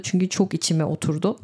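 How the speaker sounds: ordinary talk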